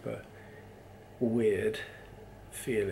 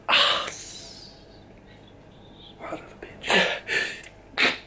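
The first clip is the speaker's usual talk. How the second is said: strained whisper; much slower than usual. Real, not acted. Painful sneeze aftermath.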